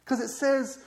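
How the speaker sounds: ordinary talk, nothing marked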